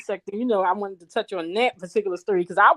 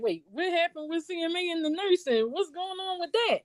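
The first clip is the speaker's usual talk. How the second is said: high, softer bewildered question; lost and asking to be filled in; confused catching up; 8 semitones higher, 7 dB quieter than usual. Retold or imitated. Host recalling her confusion about the story, high puzzled ask. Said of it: "I'm like".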